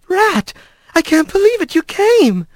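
high, joyful amazement; overjoyed greeting, pitched well up; delighted. A narrator's reading. Kylie taking Rat's paws, delighted.

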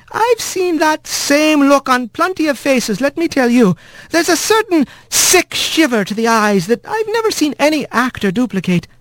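high polite nurse wren; eager little bird witness. A narrator's reading.

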